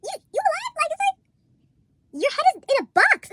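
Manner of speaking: high, stretched disbelieving cry; disbelief. Retold or imitated. Friend shocked at the talking head in a box, high disbelief. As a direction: gasp it out like you can't believe it.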